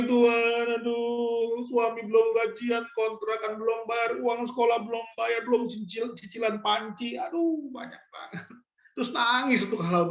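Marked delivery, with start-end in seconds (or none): 0.0-8.5 higher-pitched, hammed-up wailing to God; put-on whimpering lament, fading at the end; mock crying prayer. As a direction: imitate someone moaning tearfully over unpaid bills. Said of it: "Bukan tangisi".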